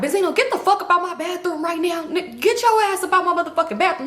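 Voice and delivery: high-pitched mock fury; angry order. Uncle orders Benzino out of the bathroom, mock-furious.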